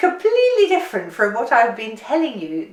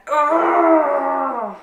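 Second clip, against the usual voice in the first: loud effortful grunt; strained grunt; 4 semitones higher, 6 dB louder than usual. Shown as a demonstration. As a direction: grunt as if squeezing hard. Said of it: "Squeeze it as hard as you can".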